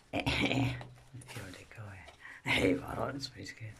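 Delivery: low whispered cooing; tender whisper. Real, not acted. Mother whispering endearments to baby Chanel.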